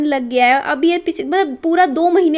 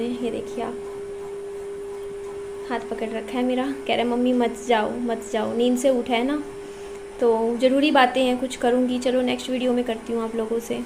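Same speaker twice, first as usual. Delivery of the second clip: much softer, keeping the voice down; 8 dB quieter than usual. Real, not acted. बेटा हाथ पकड़े, सो रहा. (हाथ पकड़े बेटे के पास, धीमी दबी आवाज़ में)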